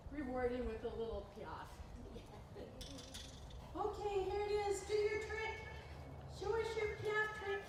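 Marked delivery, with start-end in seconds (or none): 3.7-7.6 much higher coaxing pet voice; high sing-song praise for a good horse; talking to the horse. Acted. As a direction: coax him like a beloved pet, up high.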